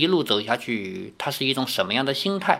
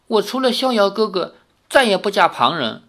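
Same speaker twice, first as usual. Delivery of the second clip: put-on young girl's voice, higher, pleading through tears; tearful plea; 6 semitones higher than usual. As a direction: beg the aunt, close to crying. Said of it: "赵灵儿忍不住又落着泪". (少女含泪哀求)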